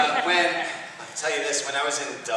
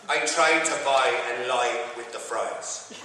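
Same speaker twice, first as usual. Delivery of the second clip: slow sentence with Irish long I; Irish accent, every "I" as uh-ee; Irish vowel demo; slower than usual. Shown as a demonstration. Dialect lesson for the audience, Irish long I. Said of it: "the Irish dialect has a very clear change".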